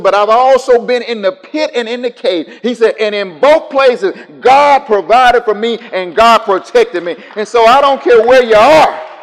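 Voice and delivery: loud, high preaching with a laugh; impassioned preaching. Acted. Preacher voicing David's trials to the church, loud. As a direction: cry it out with joy.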